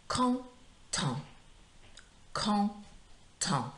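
"content" drawn out syllable by syllable, high first syllable, silent final T; model pronunciation. Shown as a demonstration. Teacher modelling "content" for pupils to repeat, slow careful pronunciation. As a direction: say "content" in two separated nasal syllables, ending without a T. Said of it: "repeating after me".

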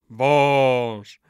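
loud, drawn-out booming echo; chorus response. A narrator's reading. Warriors echo the curse, booming chant. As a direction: boom it back as a crowd.

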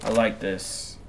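normal talking voice

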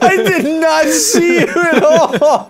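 giggling disbelief; laughing. Real, not acted. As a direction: crack up while saying it. Startled by friend's invisible character.

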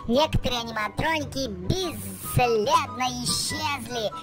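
fast, high play-by-play; excited race commentary. Acted. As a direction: call the race live.

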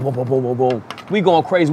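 ordinary talk, nothing marked

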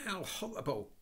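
high, shrill-free horrified outburst; girl's high horrified cry. Acted. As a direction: recoil in disgust aloud. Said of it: "cried Anthea".

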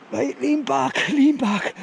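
high tense voice from the cold; strained, bracing against the cold; in ice cold water. Real, not acted. Easing back into cold water.

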